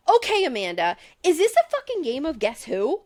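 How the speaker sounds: exasperated demand, pitch jumping up; annoyed. Real, not acted. Host scolding Amanda for not explaining, exasperated. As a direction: challenge her with mounting impatience. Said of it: "I'm so annoyed with her".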